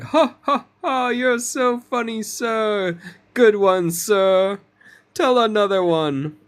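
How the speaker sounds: high, loud fake courtesy laughter; hammed-up laughing to flatter a boss; polite laugh. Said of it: "they're doing this courtesy laugh for the king".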